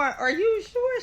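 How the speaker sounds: high-pitched disbelieving question; acted-out disbelief, pitch lifted well up; incredulous questioning. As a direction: ask it high, demanding confirmation. Repeats shocked question to pregnant rival.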